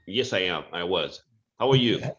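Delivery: ordinary delivery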